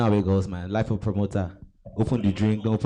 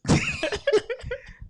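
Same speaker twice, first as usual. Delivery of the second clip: high laugh; 4 dB quieter than usual. Real, not acted. Laughs at government joke.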